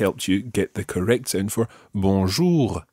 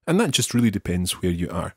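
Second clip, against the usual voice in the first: ordinary talk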